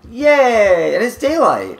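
high, loud happy cheer; excited cheer. Real, not acted. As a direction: cheer with relief. Cheering as daylight returns.

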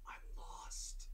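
tiny unvoiced whisper; helpless, barely-there whisper; lost whisper. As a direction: whisper it like someone lost in the dark. Lost man answers in night cabin.

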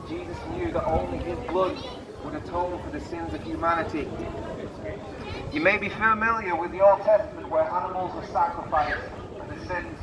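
ordinary delivery

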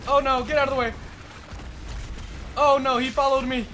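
panicking gamer. Real, not acted. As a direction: babble in a rush of panic. Frantic gameplay under attack.

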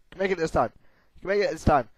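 usual speaking voice

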